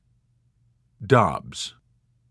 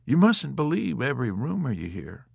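self-important; 4 semitones higher than usual. A narrator's reading. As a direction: point it out loftily. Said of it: "with a self-important air".

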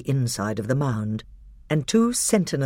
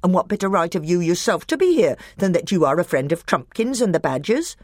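higher, louder resentful retort; higher aggrieved dwarf; defiant dwarf; 6 semitones higher, 5 dB louder than usual. A narrator's reading. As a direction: push back hard, rising to a shriek. Nick a Brick defiant.

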